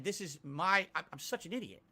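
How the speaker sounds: usual speaking voice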